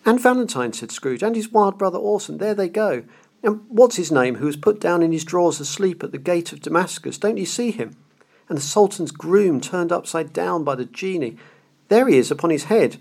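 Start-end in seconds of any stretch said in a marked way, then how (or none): 0.1-0.7 put-on higher, excited old man lost in memory; ecstatic Scrooge. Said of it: "Scrooge exclaimed in ecstasy". 1.2-13.0 put-on higher, excited old man lost in memory; ecstatic Scrooge. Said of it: "Scrooge exclaimed in ecstasy".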